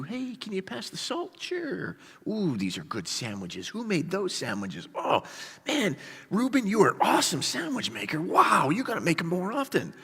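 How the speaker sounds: high, light, chummy small talk; hammed-up oblivious cheer; carefree picnic chatter. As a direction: gush over lunch as if nothing is wrong. Brothers chatting happily at picnic.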